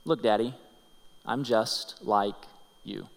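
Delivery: slow hushed refrain, look Daddy; tender refrain. Acted. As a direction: linger on each word like a gentle refrain. Daughter's message, slow refrain.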